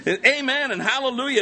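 high, rousing cry; shouted praise. Acted. Preacher urging congregation to join in, shouting. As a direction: belt it out to get them into it. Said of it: "I'm doing the shouting right now".

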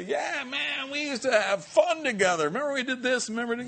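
high, lively reminiscing; mock nostalgic buddy. Party friends reminiscing about good times, high and lively. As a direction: gush like an old party friend.

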